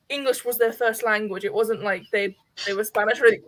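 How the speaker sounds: normal talking voice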